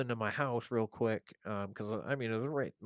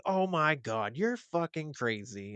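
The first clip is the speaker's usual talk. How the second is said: mock-exasperated ex-wife; 6 semitones higher, 6 dB louder than usual. Ex-wife voiced in retold story.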